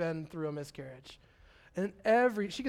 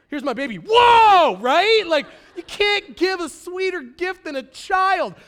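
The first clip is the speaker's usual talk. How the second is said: excited outburst; 5 semitones higher, 9 dB louder than usual. Retold or imitated. Joyful outburst about adoption gift.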